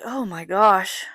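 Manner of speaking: retold relief, long drawn-out exclamation; relieved. Mother at the restaurant after her son's surgery, relieved. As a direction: let it out slowly and loud, relieved. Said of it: "I was so relieved".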